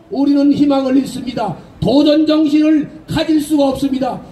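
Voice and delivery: normal talking voice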